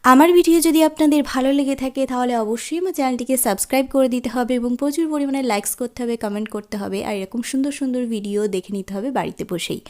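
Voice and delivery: loud, fast subscribe plea; eager outro pitch. Real, not acted. Host urges viewers to subscribe, loud and fast. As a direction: rush the outro with energy.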